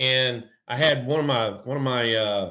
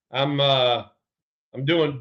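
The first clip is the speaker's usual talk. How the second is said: ordinary talk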